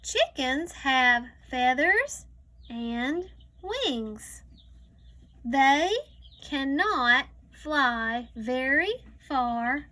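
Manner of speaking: slow, careful read-aloud; reading to a child. Acted. Teacher reading a farm book to a child, slow read-aloud. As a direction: read each word slowly for a young learner.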